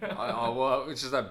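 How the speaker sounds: ordinary talk, nothing marked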